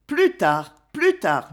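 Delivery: ordinary delivery, nothing marked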